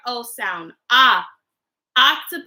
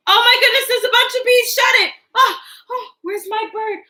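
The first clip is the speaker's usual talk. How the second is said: much higher, excited pretend alarm; 6 semitones higher than usual. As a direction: act startled by a swarm of bees. Bees after opening the window.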